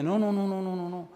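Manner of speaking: frightened refusal. Retold or imitated. Alarmed protest. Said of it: "Y se asustó el hombre".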